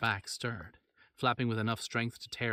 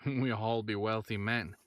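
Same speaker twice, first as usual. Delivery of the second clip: slow, thick Quebecois drawl with a chuckle; a gruff Quebecois blacksmith, amused at the prize; thick Quebecois accent. Acted. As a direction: blacksmith grinning over a fortune, heavy Quebecois accent. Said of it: "he said in a thick Quebecois accent".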